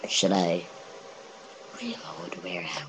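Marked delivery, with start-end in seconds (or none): 1.8-2.9 whispering. Real, not acted. High whisper.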